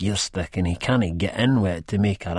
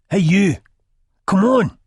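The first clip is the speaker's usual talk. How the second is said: loud, high menacing order; bully her into moving; sharp threatening call; real menace; 12 semitones higher, 7 dB louder than usual. A narrator's reading. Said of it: "with real menace in his voice".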